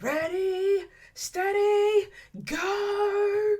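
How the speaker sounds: high, put-on Australian starting call; movie quote. Vlogger quoting her favorite line, high put-on Australian call. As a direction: call it like a race starter in the film.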